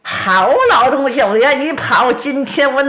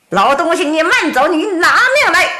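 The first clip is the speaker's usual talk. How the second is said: higher, rising shout of a threat; threatening shout; 7 semitones higher than usual. Acted. Qin Wenhuan shouts a threat after the fleeing old man, rising. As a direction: call after him like a hunter closing in.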